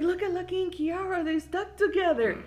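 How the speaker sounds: put-on high-pitched kid telling dad; mock alarmed report. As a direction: imitate a child urgently pointing out trouble. Retold child alerting dad.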